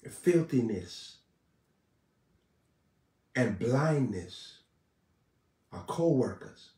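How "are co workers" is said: ordinary delivery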